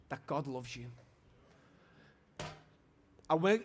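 ordinary delivery

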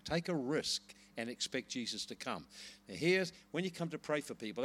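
ordinary talk, nothing marked

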